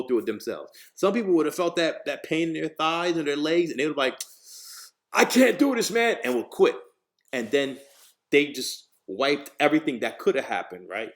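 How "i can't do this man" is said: high, loud mock complaint; acted quitter's outburst. Someone in leg pain giving up on the job, exasperated.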